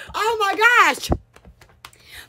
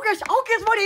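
ordinary delivery

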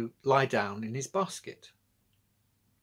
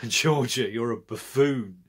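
howling; 5 dB louder, slower than usual. A narrator's reading. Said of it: "howled her brother".

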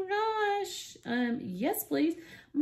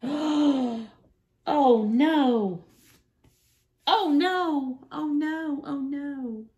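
loud, high oh no of dismay; real loud dismay; dismayed cry; 5 semitones higher, 7 dB louder, much slower than usual. Real, not acted. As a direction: cry out as the powder spills.

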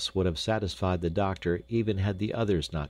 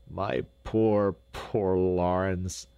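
slow, drawn-out lament; a father mourning his boy, understated; grieving; much slower than usual. A narrator's reading. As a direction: linger sorrowfully on each word. Father grieving for his lost son.

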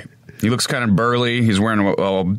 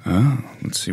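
ordinary talk

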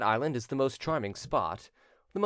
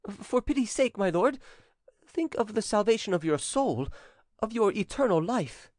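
high-pitched servant's plea; anxious pleading; 9 semitones higher than usual. A narrator's reading. Servant begging his lord to think of salvation, pleading. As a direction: implore your master earnestly, voice pitched up.

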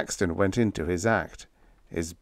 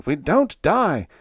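ordinary delivery, nothing marked

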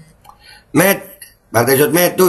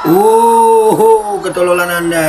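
loud, high drawn-out "oh"; mocking echo; 4 semitones higher, 3 dB louder, much slower than usual. Reactor mocking a politician's "ketololan Anda", mock-shocked echo. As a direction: jeer it back, stretching the opening. Mockingly echoes the played clip.

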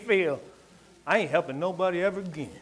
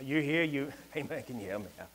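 beggar voice; 5 semitones lower, 10 dB quieter than usual. Retold or imitated. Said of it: "he came up and was like".

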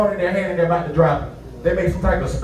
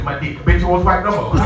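ordinary talk, nothing marked

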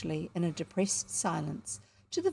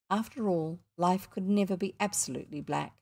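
usual speaking voice

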